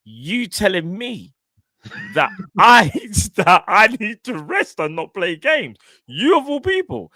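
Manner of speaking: high, drawn-out incredulous player's retort; indignant player. Retold or imitated. Star player offended at being benched by the manager, indignant. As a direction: play the affronted star, voice climbing. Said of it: "look at him and think".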